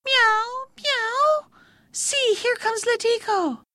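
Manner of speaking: very high mewing cat voice; calling cat. A narrator's reading. Cat on the roof heralding Letiko, mewing call. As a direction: mew it out high and thin, then announce. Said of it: "cat on the roof ... called out".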